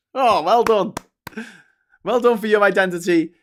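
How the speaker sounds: loud, high mock congratulation; sarcastic praise. Mocking English people for their identity, loud sarcastic praise. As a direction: pile on the fake applause.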